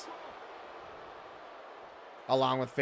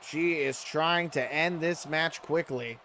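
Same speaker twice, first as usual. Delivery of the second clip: wrestling commentator; 3 semitones higher, 3 dB quieter than usual. Acted. Live wrestling game play-by-play.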